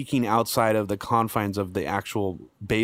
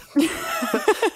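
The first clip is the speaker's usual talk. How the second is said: high laugh. Real, not acted. Laughs at own impression.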